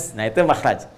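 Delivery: normal talking voice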